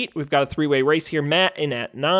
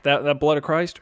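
ordinary delivery

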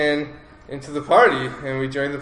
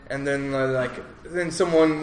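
usual speaking voice

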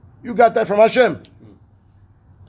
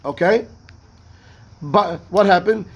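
ordinary delivery

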